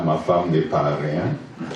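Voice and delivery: ordinary talk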